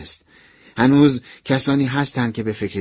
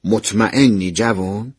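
slow, low and firm; lightly voiced stern professor, lower and drawn out; firm questioning; 4 semitones lower, slower than usual. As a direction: question a young student with slow, measured authority. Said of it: "با قاطعیت گفت".

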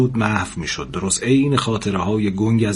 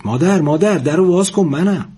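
raised, higher, urgent call; 7 semitones higher, 4 dB louder than usual. A narrator's reading. Son calling to his mother through the door, voice raised.

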